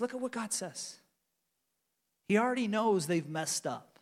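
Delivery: ordinary talk, nothing marked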